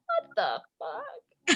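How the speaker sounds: put-on high, soft, drawn-out little kid voice; imitated child. Parent mimicking Avery saying the F-bomb, imitated child voice. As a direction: mimic a child copying a swear, high and slow. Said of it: "And she goes".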